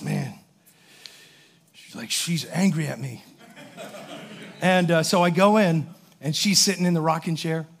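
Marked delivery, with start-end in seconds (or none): 0.0-3.2 much lower and softer, nervous; a sheepish, hushed confession; hushed scared quote. Retold or imitated. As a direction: say it like a kid afraid his grandma will hear. Scared kid afraid of grandma.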